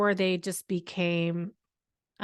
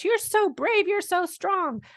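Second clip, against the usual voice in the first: much higher, sing-song gushing praise; a mother mimicking what outsiders tell her, in a high mocking gush; 11 semitones higher than usual. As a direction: parrot the outsiders' syrupy praise, pitched way up. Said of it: "when people on the outside say to us".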